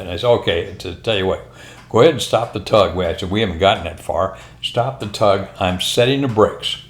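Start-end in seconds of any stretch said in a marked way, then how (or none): none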